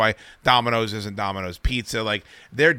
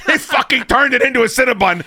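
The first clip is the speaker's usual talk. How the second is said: laughing; 10 semitones higher, 5 dB louder than usual. Real, not acted. Laughing through his cinnamon butter story.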